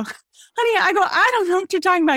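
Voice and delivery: high, loud and fast, gently baffled; gently baffled, telling the kid she has no clue; baffled honey. Retold or imitated. Teacher to kid, high, fast and baffled. Teacher confused by kid's word.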